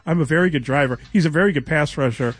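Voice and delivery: mock the coach as a rote-reciting Rain Man, lightly; Rain Man impression. Host mocks the coach to his co-host, lightly voiced Rain Man. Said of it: "he didn't sound like Rain Man".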